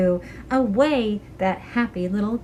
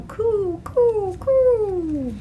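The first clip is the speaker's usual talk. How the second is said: 1 pigeon coo; 9 semitones higher, much slower than usual. Acted.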